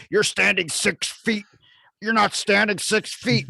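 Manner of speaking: high, slow, drawn-out imitation of a busybody; put-on high nagging voice; mock scolding stranger. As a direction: stretch it out as a complaining shopper. Acts out scolding stranger in store.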